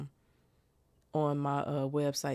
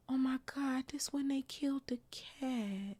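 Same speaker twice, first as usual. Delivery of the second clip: shocked whisper; 9 semitones higher, 5 dB quieter than usual. Real, not acted. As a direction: whisper it as if the scene hurts. Shocked aside about the cat.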